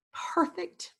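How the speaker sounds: high, soft cooing; cooing grandma. Acted. Grandma cooing over the finished cake, high and soft. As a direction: coo it with delight. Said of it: "Grandma cooed".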